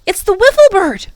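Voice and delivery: high, loud shout of discovery; young boy shouting with excited surprise. A narrator's reading. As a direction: shout it out, thrilled to spot the bird. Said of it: "then he shouted".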